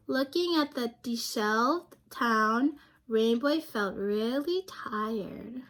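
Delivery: lively picture-book narration for a class; reading to children. A narrator's reading. Teacher reading a picture book aloud to her class. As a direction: read it like story time for young kids.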